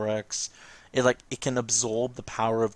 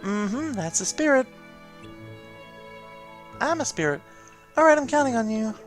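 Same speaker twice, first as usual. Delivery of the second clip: encouraging familiar character; 10 semitones higher than usual. Acted. Familiar sending the player off to defeat Heartless, encouraging. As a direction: cheer them on as the game mascot.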